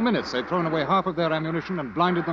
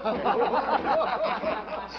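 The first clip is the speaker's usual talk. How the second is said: high jeering laugh; mocking laugh; 11 semitones higher than usual. Soldiers jeering the new regiment, a mocking laugh. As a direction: laugh at the raw recruits.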